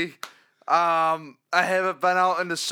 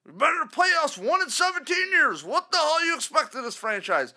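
higher, louder mocking caller voice; 9 semitones higher, 4 dB louder than usual. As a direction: sneer it like the know-it-all fan. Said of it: "you're gonna say".

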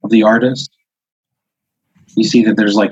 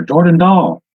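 higher-pitched call of a name; voice calling from inside; 8 semitones higher, slower than usual. Acted. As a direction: call it out from another room. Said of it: "and you hear".

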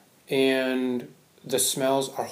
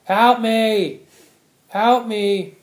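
loud, high desperate calls of help; imitated cry for help; 9 semitones higher, 10 dB louder, much slower than usual. Imitating a nursing home resident yelling for help, loud and high. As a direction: yell it out like the old woman in the corner. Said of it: "she's gonna".

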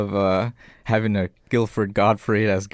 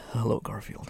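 Jigsaw voice; 10 dB quieter, slower than usual. Retold or imitated. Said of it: "I would love him to be".

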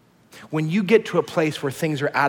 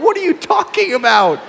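much higher, louder, disbelieving; baffled parents; 13 semitones higher, 7 dB louder than usual. Retold or imitated. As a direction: ask it in shocked confusion. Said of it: "we're going".

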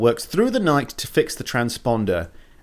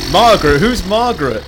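higher, louder mock Homer confusion; puzzled cartoon dad, louder; Homer impression; 7 semitones higher, 7 dB louder than usual. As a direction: bellow it like a baffled Homer. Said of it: "and he goes".

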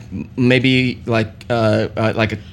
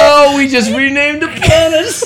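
laughing; 7 semitones higher than usual. Real, not acted. Laughing fit continues.

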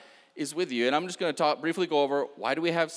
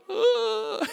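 touched aww; 3 dB louder than usual. Retold or imitated. 126 so touching.

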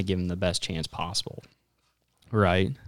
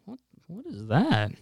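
puzzled question; 8 semitones higher than usual. Retold or imitated. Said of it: "and I'm like".

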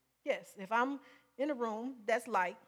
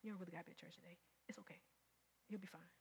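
soft, coaxing whisper of temptation; a hint of a soothing tempter; tempter's voice; 5 semitones lower, 16 dB quieter, faster than usual. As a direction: lull them into staying home. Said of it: "That's the strategy".

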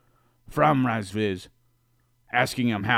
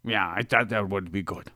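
ordinary talk

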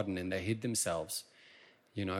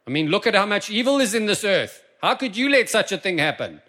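high, loud accusing preacher; fiery preaching; 7 semitones higher, 9 dB louder than usual. Real, not acted. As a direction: press it hard like a sermon climax. Preaching at full force.

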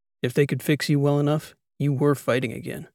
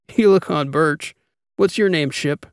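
loud, higher, amused question; amused teasing; 4 semitones higher, 5 dB louder than usual. A narrator's reading. Laughing captain asks Ship for her cover name, amused. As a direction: tease a friend over silly names. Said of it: "I was laughing".

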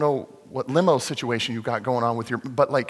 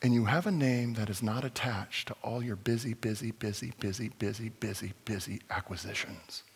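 tender appeal; 3 semitones lower, 5 dB quieter than usual. Real, not acted. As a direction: speak gently into their shame. Tender closing appeal to listeners.

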